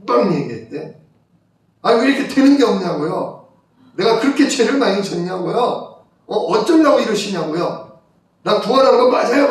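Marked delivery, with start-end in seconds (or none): 1.8-9.5 imitating an exasperated outburst, pitched up at the end; retold angry protest. Man outside venting at God, retold angry protest. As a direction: hurl mock-angry questions, moderately heated. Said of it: "진짜 화가 나서 밖에 나가가지고".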